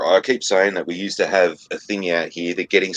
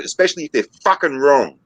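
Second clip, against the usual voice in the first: ordinary talk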